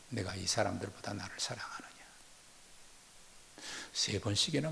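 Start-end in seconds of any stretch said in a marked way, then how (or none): 0.1-1.8 hushed whisper. Acted. Jesus gently asking Peter, in a hushed whisper. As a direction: whisper the question, fast and barely voiced.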